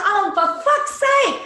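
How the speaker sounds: hammed-up irritated mum insisting she's watching; exasperated mum. Exasperated reply to kids.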